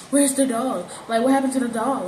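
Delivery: usual speaking voice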